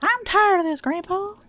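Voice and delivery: put-on high, whiny child's complaint; mock whining kid quoting the movie. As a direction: whine it like a fed-up kid. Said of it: "then is it even worth reading".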